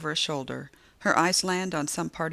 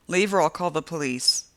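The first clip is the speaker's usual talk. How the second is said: forced authority; 5 dB louder than usual. A narrator's reading. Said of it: "with more authority than I feel".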